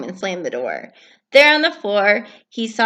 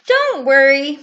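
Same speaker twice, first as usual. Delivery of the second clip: higher, louder Frog voice, soothing; calm your friend down; Frog reassuring; 6 semitones higher, 7 dB louder than usual. Acted. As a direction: kind Frog. Frog comforting Toad.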